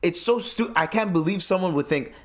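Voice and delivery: usual speaking voice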